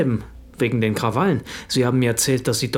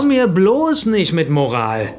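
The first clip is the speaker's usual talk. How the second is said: louder, slow and cutting; angry retort; 3 semitones higher, 4 dB louder, slower than usual. Acted. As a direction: slam the lecture down hard. Becker lashing back in the quarrel.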